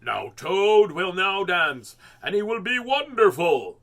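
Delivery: slow, loud grand announcement; strange announcer voice. A narrator's reading. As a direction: present the dance with a flourish. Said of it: "said the voice".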